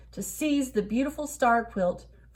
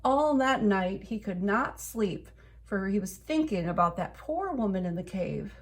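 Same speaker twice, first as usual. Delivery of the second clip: plain reading voice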